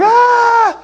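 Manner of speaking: high, loud "aah"; hammed-up shriek of alarm; mock horrified scream. Apostles aghast at the kids, mock scream.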